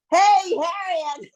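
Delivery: mocking the husband. Imitating the husband calling his wife off the phone, slow and loud. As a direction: call out lazily like a dismissive husband. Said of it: "he says".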